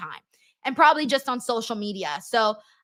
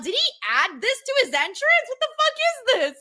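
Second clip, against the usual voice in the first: mock bewilderment; 11 semitones higher than usual. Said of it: "I'm like".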